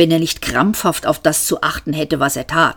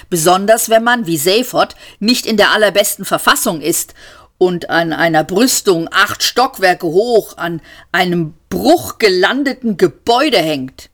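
plain reading voice

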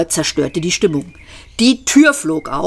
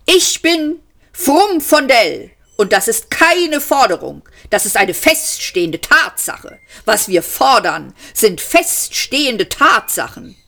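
ranting demand; 7 semitones higher than usual. A narrator's reading. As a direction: hammer the point home. Frumfondell ranting, hammering desk. (hohe, laute, empörte Tirade)

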